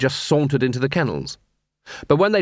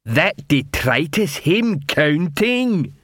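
slow, drawn-out troll amazement; amazed troll; 5 semitones higher, much slower than usual. Acted. Fallen troll about Detritus, slow amazed wonder. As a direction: marvel that he can count. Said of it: "in amazement".

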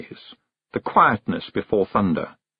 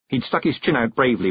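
plain reading voice

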